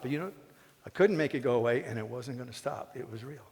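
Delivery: normal talking voice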